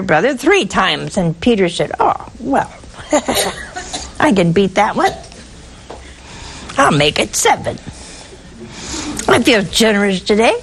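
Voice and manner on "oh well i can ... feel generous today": pleased chuckle. Retold or imitated. Peter boasts he can beat it.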